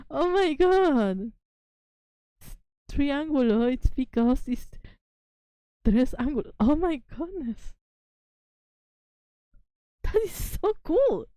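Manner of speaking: high, delighted rush of discovery; excited revelation. Real, not acted. Streamer realizing triangulo means three angles, gushing high. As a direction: gush like you just cracked a secret. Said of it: "having a moment right here of I see the truth now".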